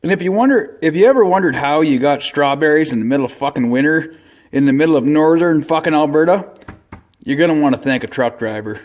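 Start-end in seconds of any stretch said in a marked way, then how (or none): none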